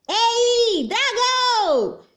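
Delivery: high, very loud yell; shouting. A narrator's reading. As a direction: yell right into his ear. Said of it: "strillò più forte che poteva".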